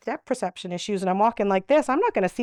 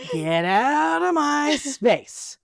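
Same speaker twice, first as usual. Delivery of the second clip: slow, higher warning; resident pushing back; 5 semitones higher, 4 dB louder, much slower than usual. Retold or imitated. Upset resident telling hugger to back off, slow. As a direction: draw it out to keep them away. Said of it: "Even worse".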